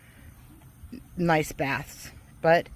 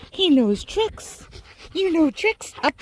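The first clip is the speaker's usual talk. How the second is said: higher, sing-song voice cooing at a pet; 10 semitones higher than usual. Real, not acted. Praising dog in baby voice.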